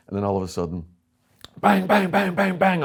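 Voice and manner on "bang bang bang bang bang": loud banging. Retold or imitated. Imitating knocking on window.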